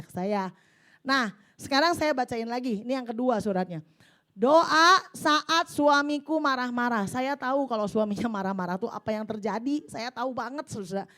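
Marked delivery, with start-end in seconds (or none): 4.3-7.1 solemn title read. Acted. Reads the letter's title.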